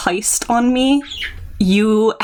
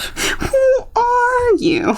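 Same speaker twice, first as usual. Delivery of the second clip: high slow shocked cry; much slower than usual. Pretend. Shocked reaction.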